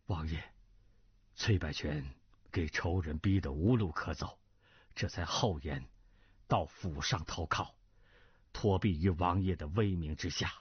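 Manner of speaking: low, contrite confession; humble confessing retainer. Acted. Cui confessing to the prince who sheltered him, low and humble. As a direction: own up humbly, pitched well down.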